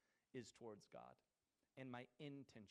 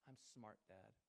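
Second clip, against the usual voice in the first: much softer, lower "I'm smart"; daughter repeating; 3 semitones lower, 9 dB quieter than usual. Retold or imitated. Little daughter answering her dad at bedtime, soft. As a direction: mumble it back like a small child. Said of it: "she repeats after me".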